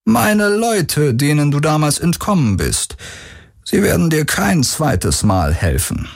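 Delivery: leicht gespielter Vater, höher und langsam; selbstgefälliger Patriarch, getragen erzählt; Stimme des Vaters. A narrator's reading. Vater verhöhnt die Tochter. (der Vater prahlt vor der Tochter mit seiner Loyalität, höher und langsam)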